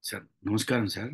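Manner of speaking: low, soft, deferential greeting; very humble. Shown as a demonstration. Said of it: "అదొక పెద్ద వినయపూర్వకం". (వినయపూర్వకంగా)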